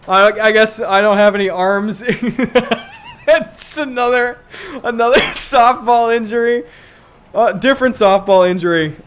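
high, loud, laughing as you talk; can't stop giggling at it; breaking up over the joke. Real, not acted. Laughing at armless sim.

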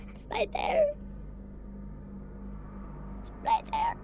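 tense high whisper. Real, not acted. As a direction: whisper so it won't hear. Monster nearby.

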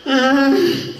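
put-on high old-lady groaning; mock feeble "ay", pitched high; frail old woman. Imitates old woman with cane.

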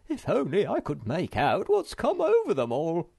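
high, loud bewildered grumble; muttered grumble. A narrator's reading. Hemulen cannot understand the others, grumbling. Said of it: "he muttered".

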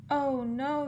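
high alarmed cry; yelled. A narrator's reading. Friend yelling in alarm as the cake batter rushes by. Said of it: "yelled Walden".

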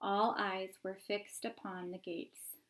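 usual speaking voice

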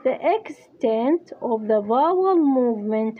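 English phrase read out slowly; slow dictation. Shown as a demonstration. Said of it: "بالإنجليزي". (إملاء بطيء للعبارة)

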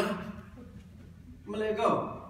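put-on slow drawn-out Australian accent. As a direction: stretch it out in a put-on Aussie voice. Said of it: "this awful Australian accent".

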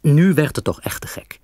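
plain reading voice

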